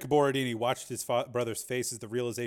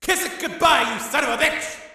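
much higher, louder scream; 10 dB louder than usual. A narrator's reading. Tommy screaming down at Sharp, full scream. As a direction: scream it across the arena. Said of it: "screamed Tommy".

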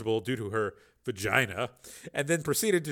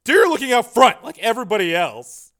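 dismissive; 11 semitones higher, 13 dB louder than usual. Acted. Redhead brushes off cops.

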